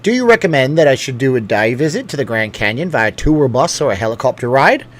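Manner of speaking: hammy attempt at an Aussie accent; badly faked Australian accent. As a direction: put on a clumsy Australian accent. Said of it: "do it in a bad Australian accent".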